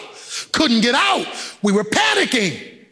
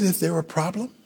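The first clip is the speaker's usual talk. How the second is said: lower, softer calm operator; 6 semitones lower, 6 dB quieter, faster than usual. Retold or imitated. A hint of the elevator operator on the phone, calm and softer. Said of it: "A voice said".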